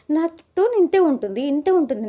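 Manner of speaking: imitated loud retort. Madhuri insisting to Divya this is just her tone, mock shouting. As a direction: throw it back as if yelling is just your tone. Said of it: "మీరెందుకు అలా అరుస్తున్నారు".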